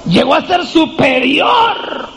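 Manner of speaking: preacher exulting over Joseph's rise; triumphant shout. Acted. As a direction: proclaim it in triumph.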